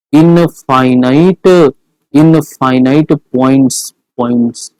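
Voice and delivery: stretched syllables, word by word; slow drilled answer. Shown as a demonstration. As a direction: spell out the answer slowly for the class. Teacher drills the answer slowly. (धीरे-धीरे, खींचकर दोहराया जवाब)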